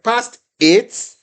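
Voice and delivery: high, loud burst of delight; excited. Real, not acted. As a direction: cheer the score out proudly. Thrilled reading out student's score.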